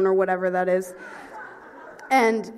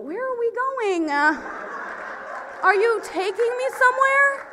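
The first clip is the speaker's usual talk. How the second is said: high mock confusion; 12 semitones higher, 4 dB louder than usual. Playing dumb about travel.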